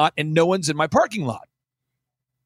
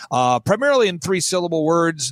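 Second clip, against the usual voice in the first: usual speaking voice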